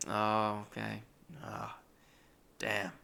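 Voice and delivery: soft, deflated imitation of a letdown; disappointed. Roommate hears narrator isn't getting paid, quietly disappointed. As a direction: let the words sink quietly. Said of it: "He was always kinda disappointed".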